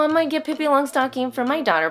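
ordinary delivery

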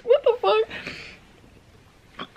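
stunned, slow and high; shocked. Real, not acted. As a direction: drag it out in disbelief. Shocked after tasting eggnog.

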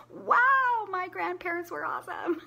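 a high, amazed grandkid's wow, laughing into it; impressed grandchild. Retold or imitated. Grandchild looking back at grandparents, high amazed laughing wow. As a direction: gush like a proud grandchild.

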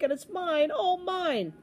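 lightly voiced gloating king, rising high. King exults over his oobleck, gloating.

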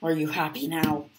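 ordinary delivery

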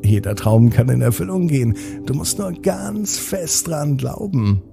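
plain reading voice

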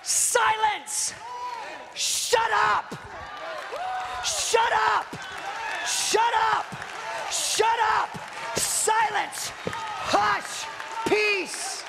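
high, fierce rebuke; commanding shout. Acted. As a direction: shout the storm down like a master. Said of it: "And then he shouted".

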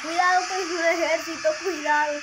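loud fast urgent warning; alarmed shout. Real, not acted. Warning of an army in game.

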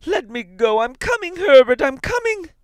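very high, frantic loud calling; struggling cry. A narrator's reading. Mother calling to her son at the door, frantic shriek. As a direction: scream to get free and call out. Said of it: "she cried, struggling".

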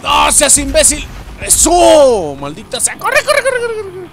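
high, loud urging with a laugh; frantic shouting. Real, not acted. Gamer fleeing a monster, frantic laughing yells. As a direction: yell at yourself to run.

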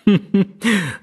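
high, falling sinister giggle; amused gloating villain laugh; villainous giggle. Acted. (高い声で不気味にくすくす笑う)

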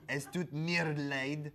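mock German accent, slow and loud; a half-hearted German accent, rising at the end. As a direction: ham up a German apology. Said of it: "I can't even think of a German accent".

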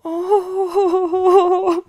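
high, loud laugh-sob; crying laugh. Real, not acted. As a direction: burst out in a teary laugh. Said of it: "that was a genuine reaction I had".

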